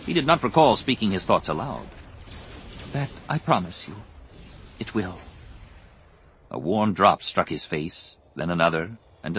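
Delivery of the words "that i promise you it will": hushed promise. A narrator's reading. Bergere quietly promises the wounded Jason.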